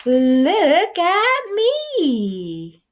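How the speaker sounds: very slow, high, preening; high slow showoff voice; showing off. Acted. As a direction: draw it out admiringly. Pip shows off fuzz.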